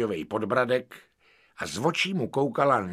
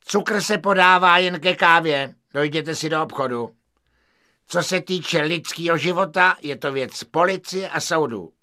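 1 bureaucratic waiter; 6 semitones higher, 4 dB louder than usual. Acted.

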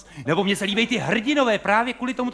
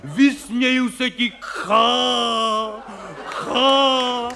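loud, higher, slow heroic bellow with a mocking laugh; hammy stage hero; 4 semitones higher, 3 dB louder, much slower than usual. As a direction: ham it up like a melodramatic actor. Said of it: "křičej tam, prostě řvou".

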